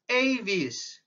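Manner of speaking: Aves with a long AY first vowel, "AY-veez"; British English pronunciation. Shown as a demonstration. Lecturer showing students how British English says the class name Aves, British pronunciation. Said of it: "in British English".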